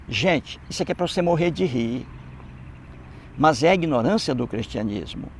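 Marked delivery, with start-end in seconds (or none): none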